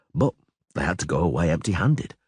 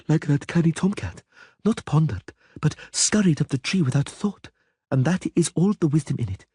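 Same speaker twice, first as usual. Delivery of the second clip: a hint of a lofty dryad, higher; calm, knowing forest spirit, lightly voiced; 9 semitones higher than usual. A narrator's reading.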